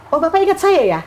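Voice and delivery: high, bright exclamation of surprise; surprised. Retold or imitated. Surprised reunion with old teacher.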